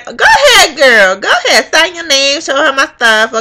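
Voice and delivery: high-pitched, cheering send-off; encouraging poll worker. Retold or imitated. Poll worker sending voter to sign, encouraging.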